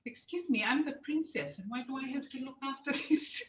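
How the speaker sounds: a hint of a haughty little princess, laughing at the end; mock princess. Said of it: "in my head".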